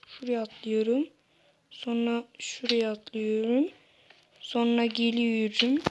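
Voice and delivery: ordinary delivery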